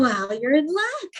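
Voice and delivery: slow, high, loud retold good news; triumphant reply. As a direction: announce it like a winning offer. Retells persuading a reluctant actress.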